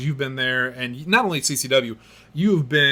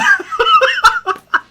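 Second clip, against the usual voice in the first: big burst of laughter; 9 dB louder than usual. Real, not acted. Reacting to shocking punchline.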